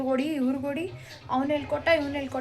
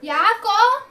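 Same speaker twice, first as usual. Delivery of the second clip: loud, high, rising shout; calling out; 9 semitones higher, 10 dB louder, much slower than usual. Real, not acted. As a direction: shout the question to someone at a distance. Mother calls out a question.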